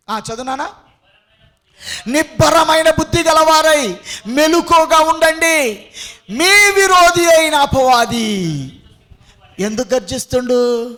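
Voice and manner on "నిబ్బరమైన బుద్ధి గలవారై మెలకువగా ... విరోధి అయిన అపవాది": loud, high verse proclamation; full-force scripture reading. Real, not acted. Preacher warns congregation from scripture, loud high proclamation. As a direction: thunder the verse out, drawing out the ending.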